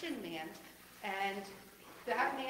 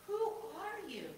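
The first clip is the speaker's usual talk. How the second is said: higher, a touch of fear; frightened question; 10 semitones higher than usual. A narrator's reading. Pastor voicing Saul frightened on the road, higher. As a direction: ask it like a scared man on his knees. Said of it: "was covering his eyes and shaking".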